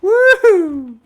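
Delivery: loud high cackling into a whoop; triumphant laughter. Real, not acted. Streamer celebrating a kill, laughing whoop.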